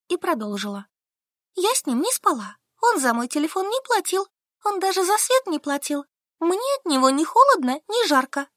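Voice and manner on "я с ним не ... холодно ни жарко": put-on higher, stubborn young woman; Solka answering. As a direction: answer flatly, digging in. Solka protests. (выше, упрямая Солька)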